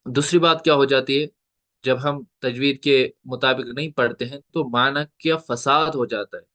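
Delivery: normal talking voice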